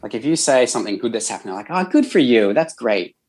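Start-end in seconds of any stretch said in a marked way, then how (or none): 1.7-3.2 lightly put-on American accent, upbeat; a hint of a cheery American voice. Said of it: "my terrible American accent".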